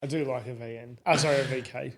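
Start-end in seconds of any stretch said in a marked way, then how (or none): none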